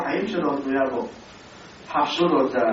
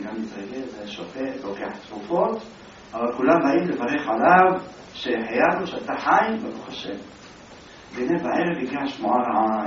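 ordinary talk

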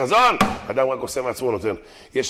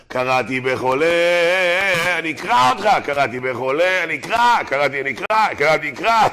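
mock prayer yell, leaping higher on each answer; imitated shouting; 3 semitones higher, 4 dB louder than usual. Man in a tallit at neilah, imitated shouting. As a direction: mimic a worshipper calling out over and over. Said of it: "הוא צועק".

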